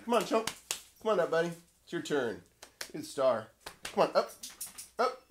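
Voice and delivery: high coaxing voice for the dog; sweet, high coaxing to get the dog on camera. Real, not acted.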